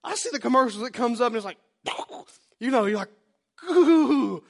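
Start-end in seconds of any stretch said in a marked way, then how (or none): 3.7-4.4 high, loud frightened ooh; mock spooked squeal; scared ooh. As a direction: squeal at the scary ad. Reacting to scary commercial.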